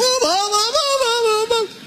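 put-on very high, piercing little-girl cries; shrill child's screaming. Little girl calling her father at the pool, shrill screaming.

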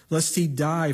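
usual speaking voice

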